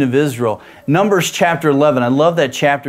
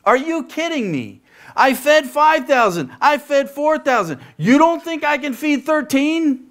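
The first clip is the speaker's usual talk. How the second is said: mock exasperated Jesus, higher; incredulous Jesus, pitched up; 11 semitones higher than usual. Jesus scolding his disciples, mock exasperated. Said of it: "Jesus is like".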